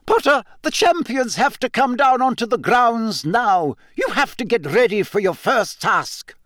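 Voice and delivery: high-pitched, urgent summons; anxious professor. A narrator's reading. Professor calling Harry to his first task, urgent.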